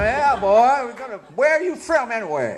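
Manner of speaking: put-on high, loud Kentucky country accent; Southern US accent. Showing Russian listeners how Southerners talk, hammed-up Southern accent. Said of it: "people talk like this".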